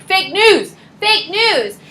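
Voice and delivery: sing-song scorn at the doctor; mock denial. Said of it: "And I'm like".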